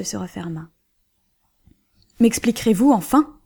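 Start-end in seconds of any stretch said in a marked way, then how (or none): 2.2-3.4 loud, demanding thunder of a question. A narrator's reading. Husband demands his wife explain herself, thundering loudly. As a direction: roar the demand at her. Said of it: "tonna Berwick".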